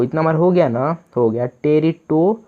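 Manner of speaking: usual speaking voice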